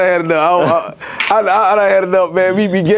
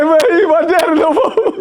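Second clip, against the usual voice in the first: laughing mock voice; 8 semitones higher, slower than usual. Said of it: "I said".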